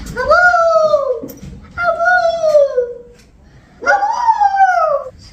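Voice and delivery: high, loud howled cries; howling like a wolf pup. Pretend. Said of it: "You're a lunatic".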